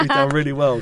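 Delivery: words squeezed through laughter; laughing. Real, not acted. Ex-coach laughing while finishing his anecdote. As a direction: still laughing as you talk.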